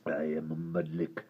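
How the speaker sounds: low, soft gravelly creature voice; deep creature voice. Acted. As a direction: retake the hideous creature line low. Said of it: "Mudlik does my voice in".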